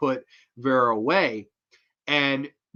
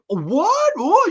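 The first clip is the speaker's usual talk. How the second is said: high, loud shocked gasp; theater crowd gasp; 14 semitones higher, 6 dB louder than usual. Retold or imitated. Whole theater reacts to the twist with a shocked gasp. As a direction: react like a stunned audience. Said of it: "everybody in the theater was like".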